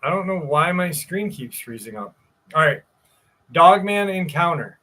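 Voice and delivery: normal talking voice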